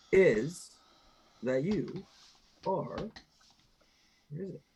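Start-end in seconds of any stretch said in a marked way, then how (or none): none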